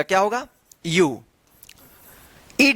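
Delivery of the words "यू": यू said alone as "yoo", falling; yu sound. Shown as a demonstration. Teacher answers what the sound will be, a falling यू. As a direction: give यू as a lone model sound. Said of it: "यहां पे यू उच्चारण होगा".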